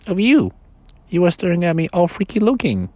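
a tiny voice begging not to be left; small toy voice. Acted. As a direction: toy bear begging the boy, small pleading voice. Sleepy's voice.

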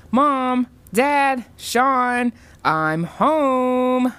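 loud, high sing-out through the house; bright-eyed girl hollering on arrival; calling out. A narrator's reading. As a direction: announce yourself to the whole household. Calls out on arriving home.

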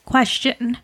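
cracked voice on Question; voice cracking. Real, not acted. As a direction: voice breaks mid-word. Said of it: "Wow, my voice just cracked".

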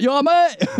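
high-pitched mock Cockney; Cockney accent. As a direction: imitate a badly dubbed Cockney, laughter creeping in. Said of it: "they made him a Cockney".

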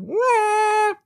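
a very high, piercing mock air horn blast; air horn imitation. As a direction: honk it out like a stadium air horn. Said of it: "Did that sound like an air horn?".

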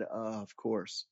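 low, soft, slow dawning reply; quiet realisation. Retold or imitated. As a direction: let it sink in, barely voiced. Retold sheepish realisation after explanation.

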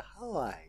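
ハワイ said as if another word; imitated ハワイ pronunciation. Mimicking co-host's attempt, odd-sounding ハワイ. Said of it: "全然違う言葉に聞こえる".

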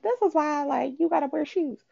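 high-pitched imitation of a scolding; hammed-up high telling-off; mock scolding. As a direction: lecture in a high, put-on voice. Voicing the heroine scolding boy.

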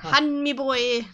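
put-on slow, loud, drawn-out Irish accent. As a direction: ham up the Irish lilt, slowly. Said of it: "he had an Irish accent".

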